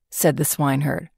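plain reading voice

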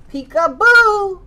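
high, loud, drawn-out peekaboo; playful peekaboo shout. Acted. Teacher to toddler, shouting peekaboo high and loud. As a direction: pop out to surprise the child.

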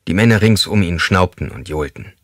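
usual speaking voice

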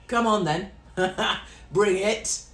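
loud, goading call with a laugh thrown in; up-for-a-fight challenge. Shown as a demonstration. Comedian showing how she squares up, loud and challenging. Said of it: "do a Katie. And when I say do a Katie, that means going".

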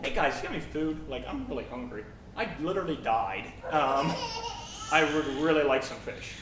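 understated, matter-of-fact punchline delivery; acted casual deadpan. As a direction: drop the big energy and ask casually. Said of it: "And he just looked at me".

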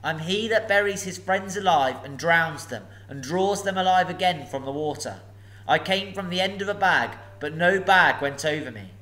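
a hint of nervous courtesy; polite hobbit. Bilbo riddles.

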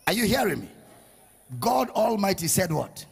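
ordinary talk, nothing marked

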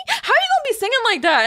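high, fast disbelief; amazed outburst. Retold or imitated. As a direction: blurt it out in awe. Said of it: "and I was like".

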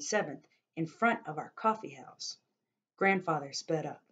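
expressive novel reading, voicing each figure; story narration. A narrator's reading. Narrator reading a chapter to listeners, storytelling voice. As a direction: tell the story to listeners, dramatically.

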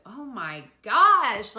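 very loud, drawn-out astonished cry; big retold shock; stunned amazement. Retelling shock at dilation news.

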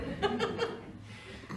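high breathless laugh; airy laugh. Real, not acted. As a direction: laugh off your own boldness. Laughing after warning her friend.

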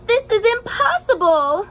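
high, loud frustrated cry; screamed line. A narrator's reading. Teacher reading to class, loud upset scream. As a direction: shout it like a kid who gives up. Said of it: "she would scream".

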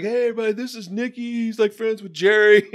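high, loud party introduction; Mike introducing. Retold or imitated. Mike introducing Nicky to the band, high and loud. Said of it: "Mike's like".

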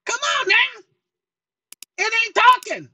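cranky alter ego. Acted. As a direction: play the cranky guy who hijacked the chair. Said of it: "I do get cranky when I'm tired".

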